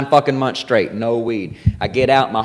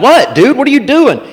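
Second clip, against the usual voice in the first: high, louder mock bewilderment; baffled friends; 12 semitones higher, 4 dB louder than usual. Friends calling to crouched buddy, baffled. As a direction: call back high and incredulous. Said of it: "They're like".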